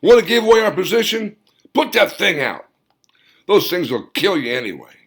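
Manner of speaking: high, loud angry snarl; furious whispered-shout turned to a yell. Acted. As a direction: snap at the old man to put it out. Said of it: "snarled at the old man".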